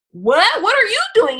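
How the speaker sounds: high, loud disbelief; can't believe who bought it; shocked disbelief. Retold or imitated. Hearing hairstylists bought her course, shocked disbelief. Retold shock at stylists buying.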